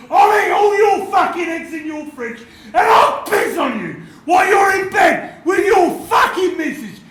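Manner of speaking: hammed-up roaring tirade; screaming and shouting. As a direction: scream threats to put the fear in them. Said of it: "while I'm screaming and shouting".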